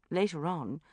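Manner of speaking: plain reading voice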